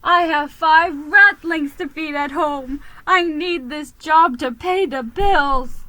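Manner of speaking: loud, high, frantic rat voice; distraught rat driver. Acted. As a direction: pour out the disaster in a panic.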